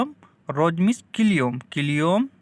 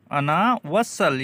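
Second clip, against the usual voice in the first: "अना वसल" spelled out very slowly, each syllable drawn out; slow it right down so students can copy "अना वसल"; slow repeat; much slower than usual. Shown as a demonstration. Teacher repeats the Arabic slowly for learners to copy. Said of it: "slow repeat of the phrase".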